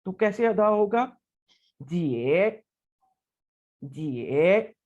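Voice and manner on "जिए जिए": जिए dipping low then rising; teacher modelling जिए in dipping third tone; third tone jie. Shown as a demonstration. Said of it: "third tone के साथ".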